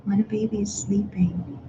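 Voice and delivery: hushed whisper. Shown as a demonstration. Parent describing a sleeping baby in a whisper.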